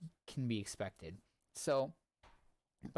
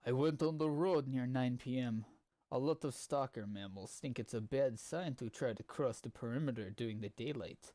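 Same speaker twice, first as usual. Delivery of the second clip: reading in a put-on Russian accent. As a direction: narrate it with a heavy Russian accent. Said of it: "I wanna be reading some of this with a Russian accent".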